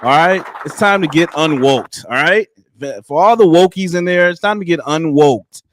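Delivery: usual speaking voice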